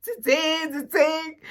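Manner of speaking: high, loud double exclaim; excited. Retold or imitated. Retelling his answer, she exclaims loudly. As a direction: belt it out high twice. Retold boyfriend's emphatic answer.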